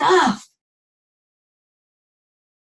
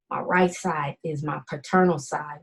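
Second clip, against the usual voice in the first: ordinary talk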